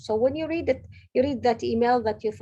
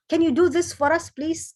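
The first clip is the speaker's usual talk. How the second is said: higher, louder pleading ask; eager request; 6 semitones higher, 6 dB louder than usual. Retold or imitated. Colleagues coming back to ask her help, eager and louder. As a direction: beg the favor with a lift.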